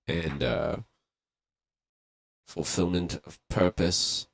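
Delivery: solemn blessing. Pretend. Blessing for a friend's newborn.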